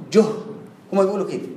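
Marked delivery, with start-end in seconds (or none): 0.1-0.2 "جه" said "juh", short u closed by h; jeem with damma. Shown as a demonstration. Teacher answering the exam question, "جه" pronounced "juh". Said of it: "جيم مضمومة".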